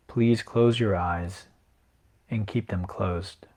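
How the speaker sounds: even script reading with long pauses; guided meditation. Acted. Yoga teacher reading Yoga Nidra to resting listeners, guided meditation. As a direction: guide listeners unhurriedly, leaving long silences between phrases.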